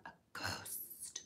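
breathy ghostly whisper; spooky whisper. Pretend.

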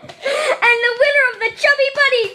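loud, high, laughing triumph; cheering own win; excited victory shout. Real, not acted.

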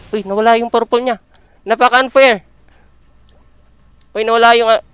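high, loud indignant complaint; outraged protest. Real, not acted. Gamer protesting to viewers that the buff vanished, high and loud. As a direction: cry foul at the unfair game.

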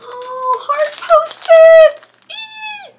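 very high, drawn-out excited squeal; delighted squeal. Real, not acted. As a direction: squeal with joy at a gift. Delighted at unboxed gift.

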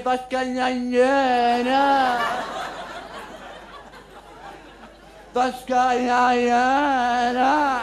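very slow mock-worried repeat; dragged hammed-up worry; slow dithering. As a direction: drag out each word in hammed-up panic.

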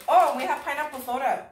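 usual speaking voice

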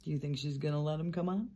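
plain reading voice

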